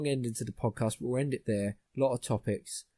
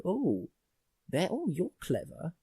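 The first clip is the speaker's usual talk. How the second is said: higher, slow mock admiration; lightly mocking awe, drawn out; mock impressed; 5 semitones higher, slower than usual. Listener awed by a snob's big words, mock impressed. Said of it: "They do it to be snobbish".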